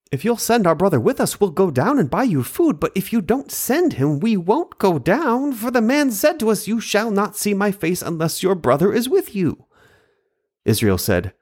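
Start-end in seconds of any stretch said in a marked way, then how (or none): 0.1-9.6 lightly voiced pleading son, higher; pleading Judah. Judah urging his father to send Benjamin, lightly voiced pleading. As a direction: press the father gently but firmly, pitched up.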